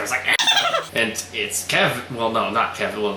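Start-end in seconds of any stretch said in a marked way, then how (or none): 0.3-0.9 very high mock-maniacal cackle; maniacal laughter. Imitating laughter heard on the walk, maniacal cackle. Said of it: "you hear this maniacal laughter".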